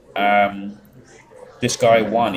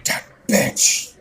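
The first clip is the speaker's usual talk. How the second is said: imitating bitter mum; 3 semitones higher than usual. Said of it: "Your mum's always going".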